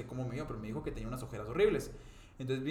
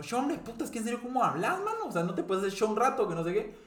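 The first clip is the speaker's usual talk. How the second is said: reclamo enojado imitado, en tono agudo; iracundo; 8 semitones higher, 4 dB louder than usual. Retold or imitated. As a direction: recrea un regaño irritado, subiendo la voz. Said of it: "yo estaba tan iracundo". (novio alterado reclamándole a su novia)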